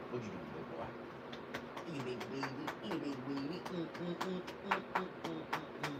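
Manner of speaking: very soft, playful pet talk; hushed, teasing baby talk; baby talk to a dog. Pretend. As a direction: coo softly to your big baby dog. Cooing to the dog.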